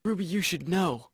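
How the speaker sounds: soft, restrained delivery; subdued take. Acted. Actor redoing the line to Ruby calmly, softly. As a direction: say it softly at your own pitch. Calm take after the warm-up.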